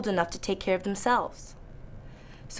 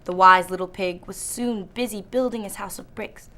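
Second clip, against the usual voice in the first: ordinary delivery, nothing marked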